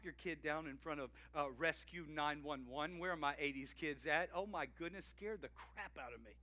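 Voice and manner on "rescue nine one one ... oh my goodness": normal talking voice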